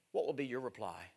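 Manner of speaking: asked low and soft, almost to himself; soft question. Acted. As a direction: let the question sink in quietly. Question repeated, soft then loud.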